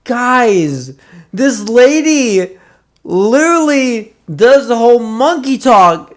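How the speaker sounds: high, loud excited retelling of the waitress; imitating waitress. Retelling a waitress's story, imitating her high and loud. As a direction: squeal it like the shocked waitress. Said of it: "she's like".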